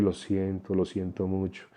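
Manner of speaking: a soft, sorrowful apology to a grieving mother; soft, gentle apology. Shown as a demonstration. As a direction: murmur lo siento with a pained, tender face. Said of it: "hablarle suavecito".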